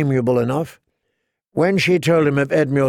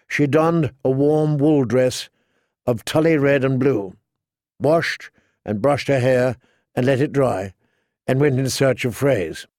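usual speaking voice

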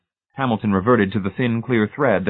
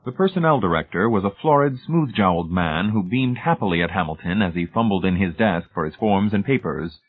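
plain reading voice

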